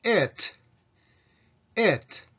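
"eat" said as "it", lax vowel near e. Shown as a demonstration. Teacher modelling the pronoun, "eat" pronounced as lax "it". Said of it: "あれをは舌を下げてeat".

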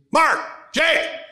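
retold yell of a harsh father calling his sons. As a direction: holler the two names like a stern dad. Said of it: "he yelled".

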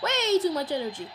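ordinary delivery

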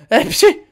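high, loud sneeze. Acted. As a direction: burst out in a squeaky sneeze. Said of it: "hepsi hapşırıyor". (hapşırma)

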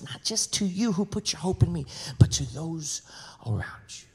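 soft voice of God. Acted. Pastor voicing God to the congregation, soft and hushed. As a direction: speak as God, gently reassuring.

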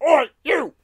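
sudden high, loud cockney yell; high shouted "Oi, you!"; policeman's shout. Retold or imitated. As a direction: bark it like a bobby. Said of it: "I wish they would have put in that".

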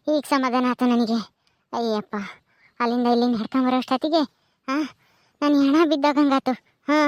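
put-on tired old woman. Old woman catching breath after walk.

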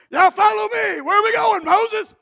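loud, high, anxious calling out; calling Israelites. Acted. Israelites calling out to Moses, loud and high. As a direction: shout after the leader.

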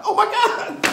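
very high, squealed amazement; overwhelmed awe. Real, not acted. Preacher in awe of an anointed poem, overwhelmed squeal.

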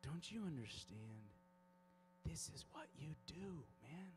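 quiet, earnest divine appeal; voice of God. Retold or imitated. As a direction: hush it right down, as God appealing to a child. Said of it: "it was like God was going".

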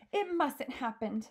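high-pitched urgent protest; storybook girl's outcry; alarmed cry. A narrator's reading. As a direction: cry out in acted distress. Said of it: "Sophie cried out".

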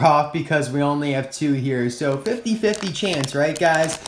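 ordinary delivery

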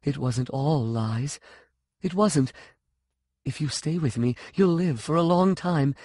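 put-on anxious, childlike confession; young, frightened. A narrator's reading. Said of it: "Her voice sounded young, frightened".

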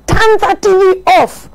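a mild mock command to switch off the TV; Obama. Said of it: "Obama akacha".

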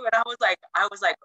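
ordinary talk, nothing marked

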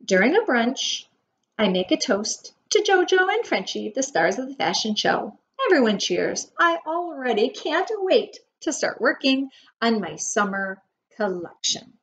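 usual speaking voice

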